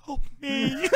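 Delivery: tiny high, soft mock cry for help; mock tearful plea. Imitating the fighter's second fake-tears plea, mock tearful plea. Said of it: "like, fake tears on his face".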